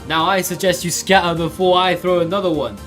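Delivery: put-on loud, high, raging old-woman voice; a high-pitched snarling old woman threatening bullies; furious old woman. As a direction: roar at the children like a furious grandmother. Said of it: "she finally spoke in a voice thick with rage".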